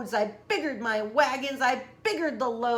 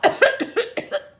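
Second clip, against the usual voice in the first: loud, high hacking cough; 11 semitones higher, 9 dB louder than usual. Acted. Lorax coughs from the smog, loud hacking cough. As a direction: hack out a cough. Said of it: "please pardon my cough".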